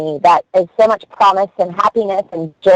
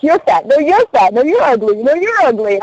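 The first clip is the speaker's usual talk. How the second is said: playful bickering; 7 semitones higher than usual. Acted. Acted playful insults among comedians.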